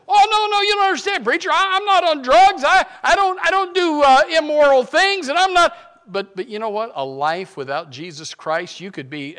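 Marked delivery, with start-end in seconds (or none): 0.1-5.8 much higher, much louder mock protest; protesting self-righteous churchgoer. Preacher voices a congregant denying need, high mock protest. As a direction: whine like someone insisting they're fine.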